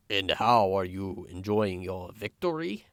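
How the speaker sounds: lightly put-on German accent; cold villain line in a German accent. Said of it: "that was my German accent".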